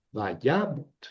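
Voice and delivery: vayamot with weight on YA and a light mot; va-YA-mot, demonstrated alone; stress on YA. Shown as a demonstration. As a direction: model vayamot, leaving the closed last syllable unaccented. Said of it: "Sílaba cerrada sin acento".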